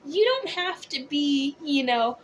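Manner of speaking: ordinary delivery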